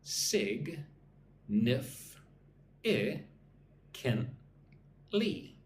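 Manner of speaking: sig, nif, I, kin, ly spaced out one by one; split into syllables. Shown as a demonstration. Teacher breaking significantly into syllables for repeating. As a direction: sound out significantly slowly. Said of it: "Listen and repeat after me, nice and slow".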